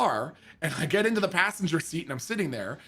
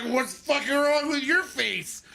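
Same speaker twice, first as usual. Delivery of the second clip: put-on high, slow drunk comeback; drunk indignant retort; 8 semitones higher, slower than usual. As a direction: toss it back, offended and slurring. Drunk retort throwing question back.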